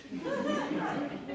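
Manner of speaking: short high giggle; high laugh. Real, not acted. Narrator giggling at Jolene's hazard, high pitched. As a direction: laugh at your own joke.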